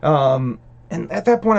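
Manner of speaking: ordinary delivery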